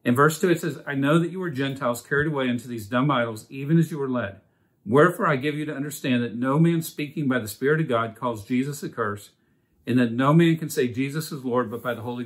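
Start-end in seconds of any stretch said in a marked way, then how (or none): none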